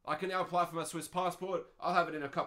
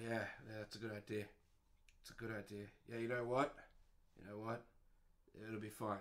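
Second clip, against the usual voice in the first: much lower, much softer dopey agreeing; mock yes-man; 8 semitones lower, 11 dB quieter, faster than usual. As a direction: mumble along like a dim yes-man. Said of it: "the other person's like".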